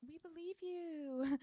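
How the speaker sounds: high, slow, insincere singsong; mock reassurance. Everyone answering Patricia's accusation, mock reassuring. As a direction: pretend to believe, pitched high.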